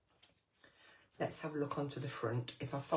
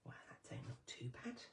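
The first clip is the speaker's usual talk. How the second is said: soft unvoiced whisper; 13 dB quieter than usual. Real, not acted. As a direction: breathe it to yourself, pleased. Pleased aside to herself.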